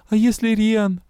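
ordinary delivery, nothing marked

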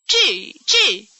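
loud, high, sudden questions; mock-startled double take; feigned startle. A narrator's reading. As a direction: pretend to be caught off guard. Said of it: "tresări în chip prefăcut".

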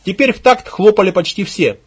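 ordinary delivery

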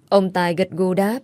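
plain reading voice

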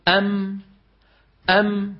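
أم closing on a held hummed m; still meem. Shown as a demonstration. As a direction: end أم with the lips shut on the m. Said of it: "لما بدك تنطق ميم ساكنة". (أم بميم ساكنة والشفتان مطبقتان)

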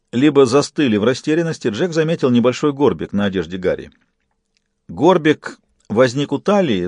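plain reading voice

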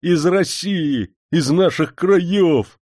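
high, slow, delighted exclaiming; overflowing with joy at news from home; a homesick servant rejoicing; overjoyed. A narrator's reading.

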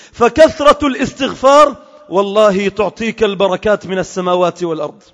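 ordinary talk, nothing marked

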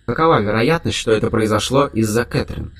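ordinary delivery, nothing marked

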